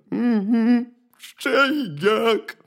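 loud, slow, high bear wail; tearful bear cub. A narrator's reading. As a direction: drag it out as a whimpering cub. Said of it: "ведмедик заплакав".

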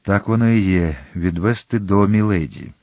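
ordinary delivery, nothing marked

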